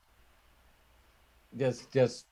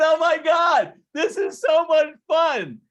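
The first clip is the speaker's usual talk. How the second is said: high, loud gushing; hammed-up girlish glee; mock giddy winner; 11 semitones higher, 6 dB louder than usual. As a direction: squeal it like a delighted lucky girl. Imitating giggling lucky card player.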